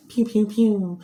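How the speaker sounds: high, playful pew pew pew; gun sound effect. Retold or imitated. The speaker mimicking the show's action, high and playful. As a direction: fire off toy-gun sounds.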